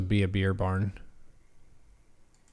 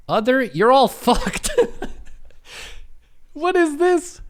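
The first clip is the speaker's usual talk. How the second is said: high voice breaking into laughter; laughing disbelief; 14 semitones higher than usual. Real, not acted. Reacting to the whole list of options, laughing disbelief. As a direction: exclaim it through incredulous laughter.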